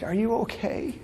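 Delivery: put-on very soft, high child's voice; little girl comforting. Five-year-old patient to her crying nurse, tender hushed concern. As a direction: ask gently, high and hushed. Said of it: "she's like".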